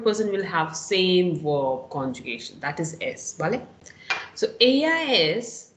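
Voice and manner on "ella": "ella" with the double L sounded as y. Shown as a demonstration. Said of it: "the sound is y".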